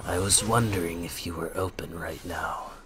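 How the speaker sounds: low, soft mock-solemn caller; half-whispered, put-on phone call.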